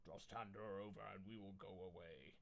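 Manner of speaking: a hushed threat from the assassin leader; menacing ninja. Acted. Ninja assassins making demands.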